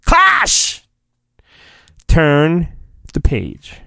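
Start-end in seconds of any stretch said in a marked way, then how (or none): 0.0-0.8 high-pitched comic clash yell; high sound effect cry. Shown as a demonstration. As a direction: shout the clang like a fight noise. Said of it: "That's the sound effect".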